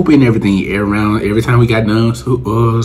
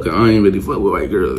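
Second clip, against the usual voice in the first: usual speaking voice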